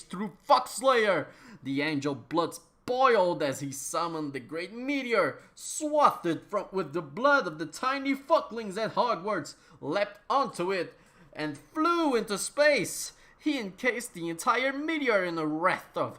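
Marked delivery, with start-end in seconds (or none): none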